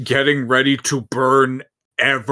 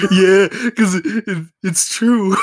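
laughing; 8 semitones higher, 4 dB louder than usual. Real, not acted. Laughing while agreeing.

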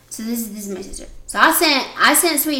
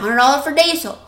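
"Hundred dollar for diesel" in a mock local twang, higher and louder; local accent; 4 semitones higher, 6 dB louder than usual. Sister repeating the local phrasing with a mock accent. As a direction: parody the townsfolk saying "Hundred dollar for diesel". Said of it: "Like, that's how they talk".

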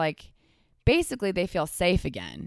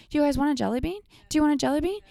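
high mocking voice; 10 semitones higher, much faster than usual. Mimicking Kelly offering jelly beans.

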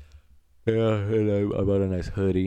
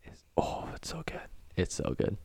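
whispered; 2 semitones higher, 9 dB quieter than usual. Real, not acted. Savouring remembered seafood.

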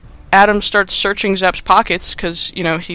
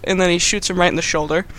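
ordinary delivery

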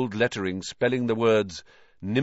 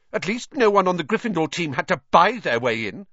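higher, louder, pointed rebuke; indignant sharp comeback; sharp retort; 8 semitones higher, 5 dB louder than usual. A narrator's reading. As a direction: cut in sharply with raised pitch. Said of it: "said Hermione sharply".

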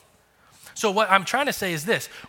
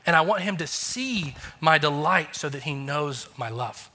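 ordinary delivery

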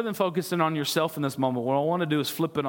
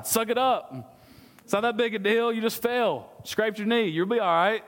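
higher pitched, brisk mock dad brushing off tears; tough-love dad; 6 semitones higher than usual. Father telling a crying child to toughen up, brisk mock dad voice. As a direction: play a no-nonsense father waving off a scraped knee. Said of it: "What does a father do when a baby begins to cry?".

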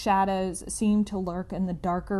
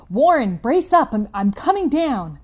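higher, loud resolute shout; 5 semitones higher, 10 dB louder than usual. Acted. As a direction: yell down to him with resolve. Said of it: "I formed and shouted a resolution".

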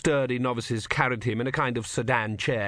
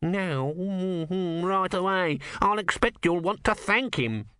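lightly voiced nervous cleric, raised pitch; a fussy young monk, pitched up; high fussy monk; 8 semitones higher, slower than usual. A narrator's reading. Numrod voiced by narrator.

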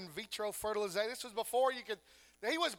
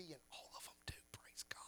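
whisper; 5 semitones lower, 21 dB quieter, faster than usual. Real, not acted.

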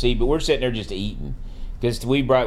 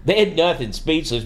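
normal talking voice

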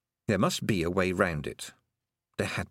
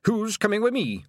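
high-pitched Scottish battle call; rally the troops with a yell; rousing pixie war leader's shout; rallying cry; 12 semitones higher, 4 dB louder than usual. Acted. Leader rallies clan, sword drawn.

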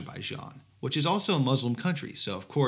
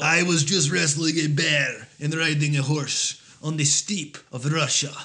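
higher and louder hammed-up Russian accent; cod Russian accent; 4 semitones higher, 5 dB louder than usual. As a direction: brag like a cartoon Russian strongman. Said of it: "that's how Russians talk".

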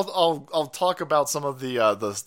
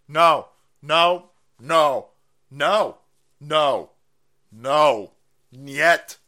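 louder, emphatic repeated no; loud refusal; 6 dB louder, much slower than usual. Real, not acted. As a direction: hammer each no down. Refusing over and over.

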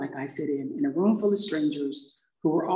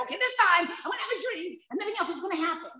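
self-deluding promise; 12 semitones higher, 3 dB quieter, faster than usual. Retold or imitated. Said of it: "I go".

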